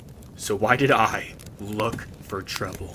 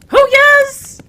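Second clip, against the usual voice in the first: much higher, very loud squeal; shrieking madman; 20 dB louder than usual. Acted. Lute player shrieks.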